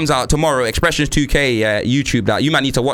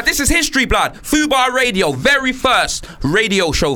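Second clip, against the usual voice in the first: excited; 8 semitones higher than usual. Real, not acted. Celebrating the show's first broadcast.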